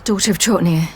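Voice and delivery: ordinary talk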